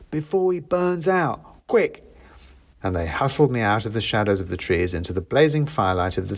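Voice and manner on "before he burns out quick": high, urgent little creature voice; mouse elf. A narrator's reading. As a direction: urge them along as a mouse. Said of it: "said a mouse, pushing at me".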